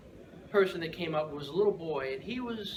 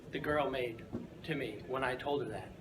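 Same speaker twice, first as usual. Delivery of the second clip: ordinary talk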